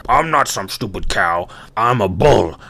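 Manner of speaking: snorting bull voice; indignant bull. Acted. Bull correcting the ant, indignant animal voice. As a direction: bellow it like an offended bull. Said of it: "And the bull goes".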